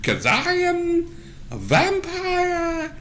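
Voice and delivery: slow, high, drawn-out vampire boast; megalomaniac rant. Acted. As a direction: proclaim your might like a mad vampire. GM voices vampire NPC boasting.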